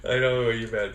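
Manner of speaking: normal talking voice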